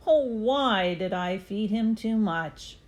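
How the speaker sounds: usual speaking voice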